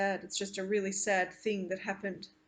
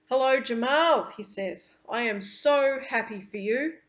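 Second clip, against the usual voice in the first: a hint of a kind older man, louder and brighter; warm greeting; 4 semitones higher, 7 dB louder than usual. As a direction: greet the boy gladly, lightly voiced. Andrew greets Jamal warmly.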